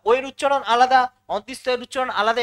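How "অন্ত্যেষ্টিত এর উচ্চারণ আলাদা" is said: ordinary talk, nothing marked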